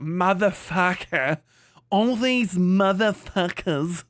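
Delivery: put-on slow, drawn-out non-American accent, R dropped; exaggerated colonial accent on American swearing; mock British Commonwealth accent. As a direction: mimic a British-style curse, stretched out. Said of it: "do you not hear what you sound like".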